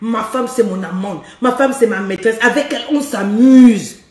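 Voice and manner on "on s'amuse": slow playful drawl; drawn out. Shown as a demonstration. Coach savouring the words for her listeners, slow and sly. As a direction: stretch "on s'amuse" out like a secret pleasure. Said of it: "coaching wives".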